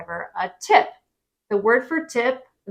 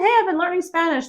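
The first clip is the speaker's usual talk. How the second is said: eager tourist; 9 semitones higher, faster than usual. Pretend. Proud tourist showing off.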